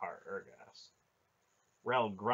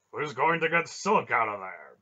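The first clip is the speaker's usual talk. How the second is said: loud, raised, forceful question; demanding; 4 semitones higher, 12 dB louder than usual. A narrator's reading. Barak asking who will rescue Silk, demanding. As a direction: demand an answer in a booming voice. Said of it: "Beric demanded".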